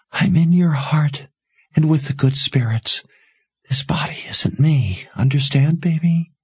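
lightly voiced dying mother, urgent and tender; a hint of a dying mother pleading; faintly voiced mother urging her to run.

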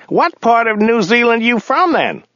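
very high-pitched driver voice; driver character. A narrator's reading. Driver confusing countries with his passenger, very high character voice.

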